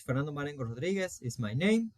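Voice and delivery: ordinary talk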